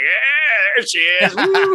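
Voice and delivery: high excited whoop; cheering. Retold or imitated. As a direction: cheer her on with a rising whoop. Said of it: "And you're like".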